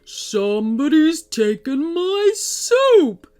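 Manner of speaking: the troll bellows in shock over his empty pot; alarmed shout. Acted. The troll finds his soup pot empty, slow loud alarmed troll shout.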